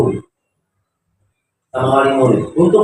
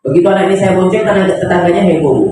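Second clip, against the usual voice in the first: ordinary talk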